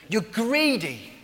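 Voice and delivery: loud, high, drawn-out charge; retold accusation. Preacher as Paul accusing the Corinthian church, loud accusation.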